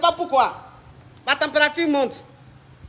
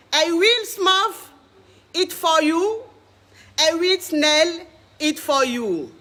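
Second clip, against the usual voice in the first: slow, deliberate English, each phrase set apart like a slogan; grandiose self-introduction; much slower than usual. Acted. Coach presenting herself to her online audience, slow and grandiose. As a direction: proclaim yourself the greatest coach, slowly.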